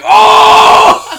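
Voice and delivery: high, very loud acted-out howl; mock pained scream. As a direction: scream like the man who fell. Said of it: "like, screaming".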